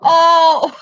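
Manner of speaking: laughing shout. Real, not acted. A laughing outburst.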